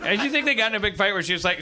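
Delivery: usual speaking voice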